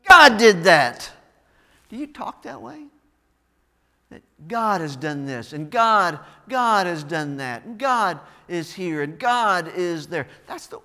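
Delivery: loud, driving preacher's cadence; fiery pulpit preaching; preaching at full force. Real, not acted. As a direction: hammer each line home to the congregation.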